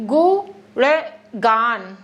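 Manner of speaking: गुणगान stretched out very slowly, each syllable clear; slow careful pronunciation. Shown as a demonstration. Teacher models careful slow गुणगान for students. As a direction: spell गुणगान out by sound, slow and deliberate. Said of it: "थोड़ी किया करो कि फटाक से बोल दे रहे हैं".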